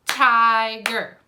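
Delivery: Tiger split into two stretched, loud syllable beats; slow, by syllables. Shown as a demonstration. Teacher clapping out syllables for children, Tiger slow and split. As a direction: say Tiger slowly, punching each of its two syllables. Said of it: "say it slowly and clap it out".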